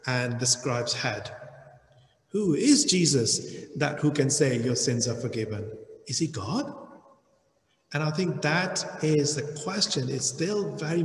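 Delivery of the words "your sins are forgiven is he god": ordinary talk, nothing marked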